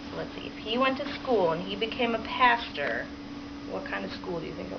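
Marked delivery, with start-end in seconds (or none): none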